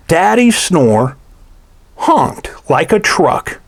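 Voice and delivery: ordinary delivery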